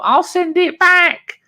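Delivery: very high, slow mock voice; high squeaky wife imitation. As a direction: lampoon her empty promise. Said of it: "she did the usual".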